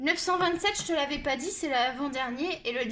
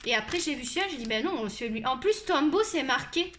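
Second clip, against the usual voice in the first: usual speaking voice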